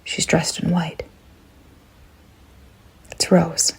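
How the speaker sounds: hushed, breathy reveal; eerie whisper. A narrator's reading. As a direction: whisper it as if she might hear. Narrator whispers a dread reveal.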